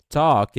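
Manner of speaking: "Talk," punched high and loud; stress on talk. Shown as a demonstration. Teacher stressing "Talk," for students. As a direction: hit "Talk," hard. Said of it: "a gente já aprendeu".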